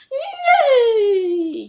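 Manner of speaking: very high loud neigh; whinny like a real horse. Pretend. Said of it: "Joey repeated".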